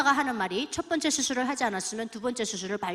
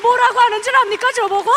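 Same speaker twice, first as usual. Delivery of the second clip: exclaiming; 11 semitones higher, 13 dB louder than usual. Real, not acted. Testimony speaker exclaiming to audience.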